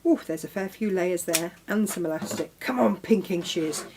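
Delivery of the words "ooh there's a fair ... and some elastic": normal talking voice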